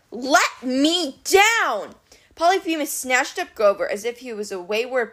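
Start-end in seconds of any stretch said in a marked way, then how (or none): 0.1-1.9 very slow, emphatic demand. A narrator's reading. Hanging captive orders the Cyclops, drawn-out demand. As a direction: stretch out each word, pitched up.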